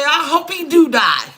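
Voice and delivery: high, loud [name] style line. Retold or imitated. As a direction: say it like [name] with attitude. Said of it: "pull a [name] and say".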